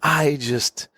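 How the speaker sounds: put-on charming storyteller, stretched words; Vasily. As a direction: draw each word out to sell the tale. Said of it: "he's trying to sell me".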